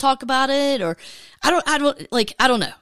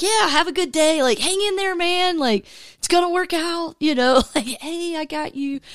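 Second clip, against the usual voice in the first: reassuring; 6 semitones higher than usual. Retold or imitated. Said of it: "I told him".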